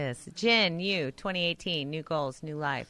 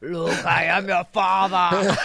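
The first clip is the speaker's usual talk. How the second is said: louder, theatrical Vader line; Darth Vader; 6 dB louder than usual. Pretend. Coworker booming the famous Vader line at the new dad. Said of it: "in a British accent".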